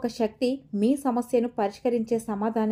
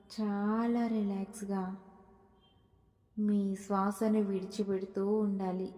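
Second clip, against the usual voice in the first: soft, slow meditation voice with long pauses; hushed, unhurried guidance through deep breaths; guided meditation; 3 semitones lower, 11 dB quieter than usual. Acted. Guide leading listeners through deep breaths, soft guided meditation.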